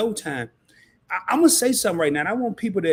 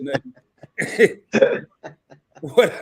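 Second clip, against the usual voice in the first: laughing it off after a slip; 5 dB louder, much slower than usual. Real, not acted. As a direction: break into a laugh at yourself. Laughs at his own remark.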